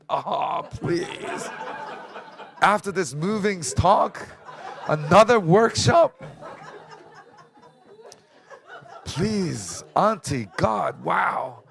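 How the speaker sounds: high, loud, drawn-out comic dismay; exasperated mock protest. Nephew balking at auntie's workshop request, mock exasperation. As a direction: wail in mock disbelief, half laughing.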